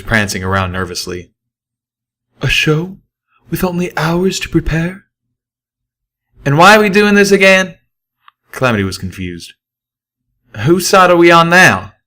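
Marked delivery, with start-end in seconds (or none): 2.4-7.8 higher, anxious singer mare voice; fretting Velvet Remedy. Acted. 10.6-11.9 higher, louder puzzled pony; confused Calamity. Acted. Narrator voices confused Calamity.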